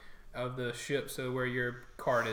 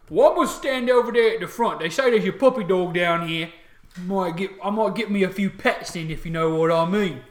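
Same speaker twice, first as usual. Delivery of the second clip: loud, raised orc voice; orc soldier; 6 semitones higher, 12 dB louder than usual. Acted. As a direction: boast to a fellow guard as a loud orc. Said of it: "You hear the first one say".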